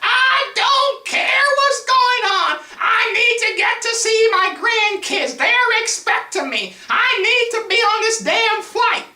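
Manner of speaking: hammed-up high-pitched drawn-out yelling; yelling old woman. Old woman on the phone demanding her flight, yelling. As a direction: holler it very high and loud, dragging the words. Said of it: "and she is yelling".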